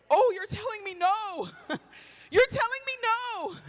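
high, slow dawning realization; high drawn-out realization. Retold or imitated. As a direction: let it sink in, drawn out and high.

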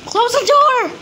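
screaming as it lunges; panicked shout. Real, not acted. Chased by the piggy.

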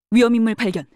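loud, urgent sighting report; alert battle call. Acted. Guard spotting a dangerous person, alert battle call. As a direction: call out the threat forcefully.

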